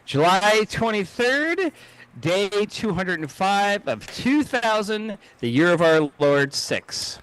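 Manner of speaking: high, drawn-out segment announcement; announcer intro. Acted. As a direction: announce the date grandly, stretching the words. Announces his history segment.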